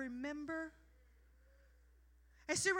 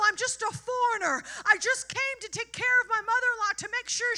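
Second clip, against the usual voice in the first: higher, louder, rising to a shout; overjoyed Ruth; 5 semitones higher, 6 dB louder than usual. Pretend. Preacher as Ruth, praising God in awe. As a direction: overflow with amazed thanks. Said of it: "And she's like".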